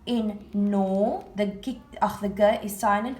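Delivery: ordinary delivery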